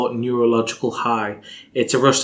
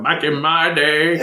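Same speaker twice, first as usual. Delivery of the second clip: put-on slow, creaky grumbling old-timer; lightly mock a grumpy old man; 4 semitones higher than usual. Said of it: "sounding like an old man going".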